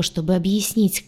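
ordinary delivery, nothing marked

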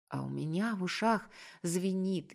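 ordinary delivery, nothing marked

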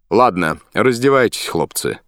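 captain's order. A narrator's reading. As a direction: give the order, easing off at the end.

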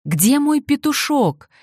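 high, loud, slow desperate cry; desperate yell. A narrator's reading. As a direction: call out frantically. Peasant searching for his rooster.

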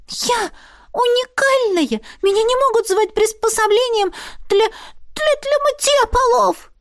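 very high, indignant squeal; sputtering with outrage, high; outraged otter squeak. A narrator's reading.

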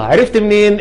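usual speaking voice

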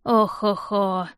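put-on slow old man's chuckle-sigh; the old man mocks the warriors from the door, slow; creaky old man. A narrator's reading. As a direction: drag it out like an old grandfather. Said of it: "знакомый скрипучий голос".

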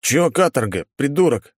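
a touch of outrage at a mate; mildly indignant. A narrator's reading. Second guard answers his complaining mate, mildly indignant. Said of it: "возмутился второй".

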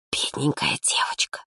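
soft voiceless whisper. A narrator's reading. Student pities the girl behind her back, whispering. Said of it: "услышала она шёпот за спиной".

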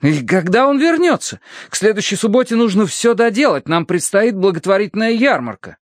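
high-pitched fussy reprimand; scolding clergyman. A narrator's reading. Pale clergyman rebukes a workman, scolding. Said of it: "выговаривал бледный священник".

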